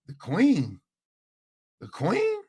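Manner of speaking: baffled question leaping high at the end; retold disbelief, voice shooting up; incredulous. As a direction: echo it back, puzzled and high-pitched. Baffled reply to strangers' odd request.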